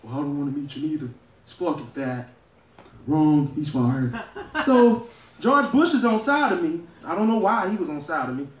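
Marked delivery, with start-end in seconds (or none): 0.0-2.3 a hint of a low, fast Trump insult; lightly voiced Trump. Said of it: "And he's like". 3.1-4.1 low, slow, drawn-out Trump verdict; Trump catchphrase. Acted.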